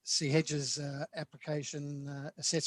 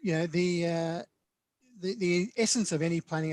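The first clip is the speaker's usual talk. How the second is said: raised, projected meeting voice; speaking louder; 4 semitones higher, 9 dB louder than usual. Real, not acted. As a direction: speak up for those struggling to hear. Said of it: "I'll speak louder".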